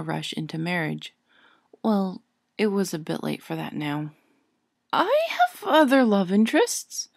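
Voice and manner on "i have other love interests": high, loud indignant protest; young witch bristling with indignation; indignantly. A narrator's reading. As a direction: insist on it, offended. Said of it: "she said indignantly".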